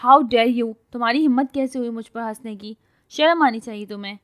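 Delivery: loud outraged yelling; furious, scold him at full volume; shouting in wounded pride; indignant yell. Acted. Fallen girl yells at laughing boy.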